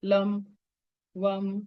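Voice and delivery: "लम" and "वम" held on an even note, each set apart; seed-mantra syllables. Shown as a demonstration. Teacher demonstrating chakra mantras to students, intoning "लम" and "वम". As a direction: intone "लम", "वम" one by one. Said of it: "त्यांच्या बीज मंत्राची".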